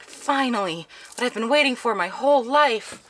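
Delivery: high-pitched, thrilled mock exclamation; a long-held wish coming true, voiced high; excited relief. Stan answers the alien's spaceship invitation, high and excited.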